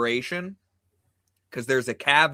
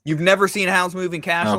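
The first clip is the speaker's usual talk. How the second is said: incredulous; 7 semitones higher, 5 dB louder than usual. Real, not acted. Incredulous at friend's answer.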